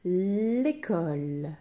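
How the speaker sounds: L'école drawn out slowly; slow dictation word. Shown as a demonstration. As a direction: stretch L'école for the pupils. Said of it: "il a un accent le A".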